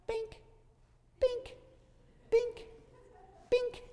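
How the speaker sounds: very high, tiny squeaky pops; tiny firework pops. Retold or imitated. Preacher imitates puny fireworks as God sees them, tiny high pops. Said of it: "he went just like this".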